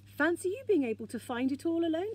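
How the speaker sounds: high-pitched, swooping admiration; admiring. A narrator's reading. Moomin Troll impressed by the find, admiring. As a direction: marvel with a lifted, lilting voice. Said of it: "said Moomin Troll admiringly".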